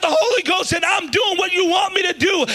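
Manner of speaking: anguished, loud, high cry of pain; crying out to God. Retold or imitated. Teenager crying out to God in pain, anguished shouting. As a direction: wail it out like a hurt teenager in bed. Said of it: "I remember crying in bed at night and saying".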